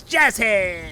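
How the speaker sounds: loud, high showman's cry; Jester's character voice. Acted. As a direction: call it out with a performer's flourish. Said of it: "wanna say it in the voice".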